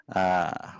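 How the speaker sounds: normal talking voice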